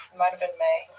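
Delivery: ordinary talk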